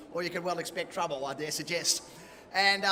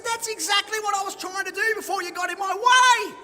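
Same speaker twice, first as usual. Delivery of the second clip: higher, louder indignant shout; retold angry shout; 11 semitones higher, 6 dB louder than usual. Patient shouting back at the hospital lady, retold indignant yell. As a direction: yell it back at her, pushing past.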